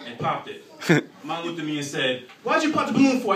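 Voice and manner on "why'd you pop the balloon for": louder, higher indignant question; mock indignant mom. Mother on the bus indignantly questioning the popped balloon. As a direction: demand it like an annoyed mother.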